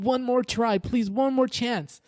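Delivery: high, frightened prayer; desperate pleading. Retold or imitated. Terrified dreamer praying for rescue, high and desperate. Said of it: "I'm freaked out in my dream".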